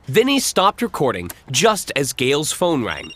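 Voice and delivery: ordinary delivery, nothing marked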